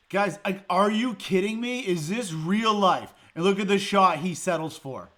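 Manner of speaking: loud and high, can't believe it; incredulous rant. Real, not acted. Fan asking his viewers if this is real, loud incredulous rant. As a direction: throw the question at the audience. Ranting to viewers at bad play.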